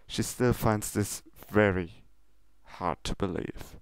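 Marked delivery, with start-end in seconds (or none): none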